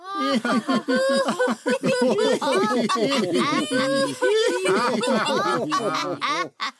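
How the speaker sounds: loud high roaring laughter; whole village laughing at the apprentice; crowd laughter. Acted. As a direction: burst out laughing, on and on. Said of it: "Das Gelächter schallte durch das ganze Dorf".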